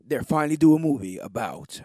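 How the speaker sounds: hammed-up trailer narrator, drawn out; movie trailer voice. Trailer announcer voice in retelling.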